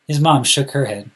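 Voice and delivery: plain reading voice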